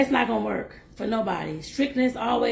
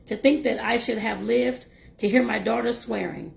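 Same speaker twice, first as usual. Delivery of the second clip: plain reading voice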